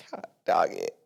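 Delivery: quiet whispered curse. Real, not acted. Venting about the husband, in a whisper. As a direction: breathe it under your breath. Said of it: "whispered exclamation".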